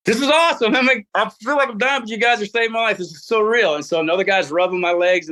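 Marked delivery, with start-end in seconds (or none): 0.1-3.8 much higher, giddy and amazed; rescued rider gushing to his helpers; giddy elation. Retold or imitated. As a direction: gush with delirious joy.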